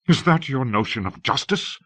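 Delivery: a touch of flaring temper. A narrator's reading. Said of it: "Ned flared".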